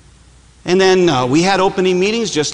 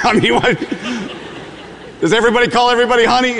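fast, higher laughing question; laughing disbelief; 8 semitones higher, much faster than usual. Real, not acted. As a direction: laugh it off in amazement. Laughing disbelief at Waffle House.

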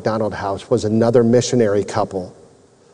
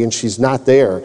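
plain reading voice

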